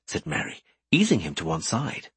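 plain reading voice

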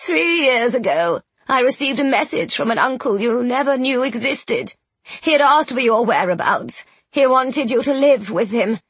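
put-on high, bitter, loud old woman; spiteful old aunt. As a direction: spit out the old grudge.

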